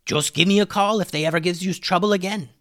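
high-pitched, put-on pirate ally voice; pirate character offering help. Said Hummus.

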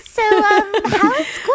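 put-on high baby voice for a teen. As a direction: coo at a teenager. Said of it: "I talk to them in the baby voice".

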